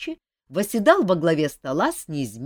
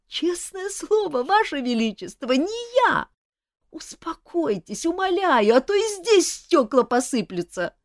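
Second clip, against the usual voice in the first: laughing jester; 11 semitones higher than usual. Acted. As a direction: plead while barely holding in laughter.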